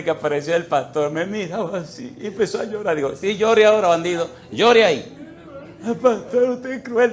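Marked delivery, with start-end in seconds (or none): 3.1-5.2 teasing loud rebuke; high, loud mock scold. Preacher scolds crying man. 5.8-7.1 high, far-off whiny complaint; high whimpering voice of a bedridden man; whining sick man. Retold or imitated. Sick man whines at pastor.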